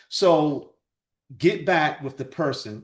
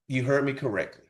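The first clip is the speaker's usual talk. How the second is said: ordinary delivery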